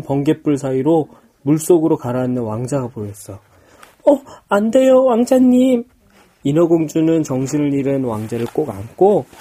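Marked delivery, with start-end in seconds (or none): 3.3-5.8 high, loud, panicked; alarmed cry. Acted. Mermaid cries out seeing prince sink.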